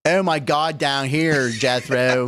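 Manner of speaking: mock blend of Southern and Pittsburgh accents. Shown as a demonstration. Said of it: "can you combine the accent?".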